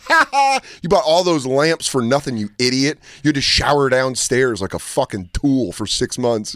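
taunting the roommate with a gloating laugh. Retold or imitated. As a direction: rub it in his face. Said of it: "and I was like".

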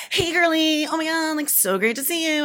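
mock her own bubbly greeting; girly greeting voice. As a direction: mom imitating daughter's take on her girly voice. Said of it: "I don't sound like that".

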